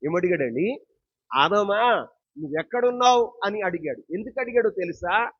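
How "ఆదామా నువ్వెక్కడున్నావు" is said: raised, higher pulpit call; projected question, lifting in pitch and volume; acted calling out. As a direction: call out as God seeking Adam. Preacher voices God calling Adam.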